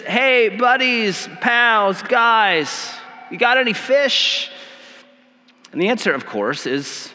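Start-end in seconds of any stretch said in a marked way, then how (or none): 0.0-4.5 higher-pitched, friendly hail to pals; casual call-out. Retold or imitated. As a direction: hail your buddies casually, stretching the greeting. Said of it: "casual term".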